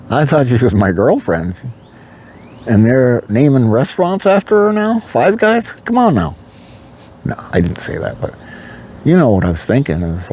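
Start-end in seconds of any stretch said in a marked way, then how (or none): 2.7-6.4 much higher, indignant joke; mock outrage. Joking to the audience about the restaurant, much higher mock outrage. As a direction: play up the disbelief.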